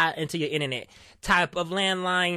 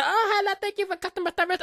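high, quick chirpy customer service greeting; lightly voiced call center agent, put-on politeness; mock agent voice; 11 semitones higher, faster than usual. Mimicking the overseas agent answering the call, in a high chirpy voice.

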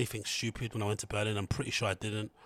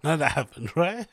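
louder, laughing through it; 7 semitones higher, 8 dB louder than usual. Real, not acted. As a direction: laugh as you deny it. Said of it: "Well, it might have happened".

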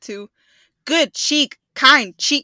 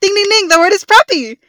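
loud, high quiz-show announcement; cheerful correct-answer call, much louder; game show ding; 9 semitones higher, 8 dB louder than usual. Acted. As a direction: ring out the right answer like a host.

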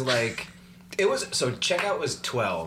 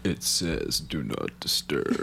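slow, much lower laughing grumble; deeper grumpy voice; 8 semitones lower, much slower than usual. Retold or imitated. Friend mocking the grumpy morning reply, low and slow. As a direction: drop the voice low and drag it. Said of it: "the voice gets deeper".

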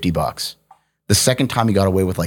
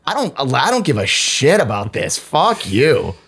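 higher, defiant mock outburst; exasperated imitated rant, pitched up; retold yell; 5 semitones higher than usual. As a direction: reenact telling someone off. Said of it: "I yelled at him".

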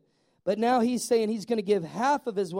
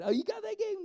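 much higher, excited fan gushing; a fan who can't believe his luck, much higher; excited squeal; 13 semitones higher, 4 dB quieter than usual. Pretend. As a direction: squeal like a thrilled kid. Excited fan voiced in story.

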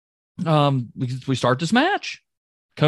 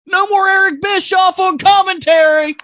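joyful shout; 7 dB louder than usual. Real, not acted. Celebrating Bischoff's exit.

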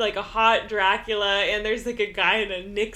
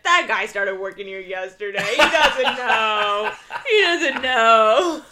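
laughing snake voice; 4 dB louder than usual. Acted. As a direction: laugh it off, dragging the words. Snake excusing the guard.

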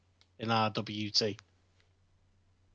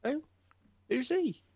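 incredulous who. Real, not acted. Baffled at an unknown wrestler.

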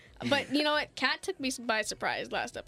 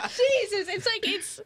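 still laughing; 9 semitones higher, slower than usual. Real, not acted. Still laughing after roast.

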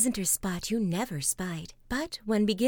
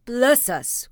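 loud, startled mayor; a startled Bless us; startled cry; 3 semitones higher, 7 dB louder than usual. Acted. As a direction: jump at the tap on the door. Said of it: "cried the mayor".

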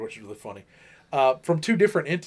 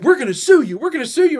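high, louder imitation of irate scammers; mock angry threat; 9 semitones higher, 6 dB louder, much faster than usual. Scammers threatening the man who ghosted them, mock angry threat. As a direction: throw out a pitched-up, hammed threat.

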